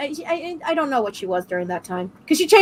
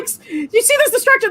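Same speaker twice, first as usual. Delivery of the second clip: ordinary delivery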